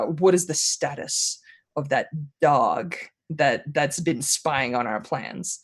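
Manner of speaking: low, slow menace; soft-spoken dark lord. Acted. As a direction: speak softly and deliberately, letting it rumble. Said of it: "he speaks softly, but his voice carries".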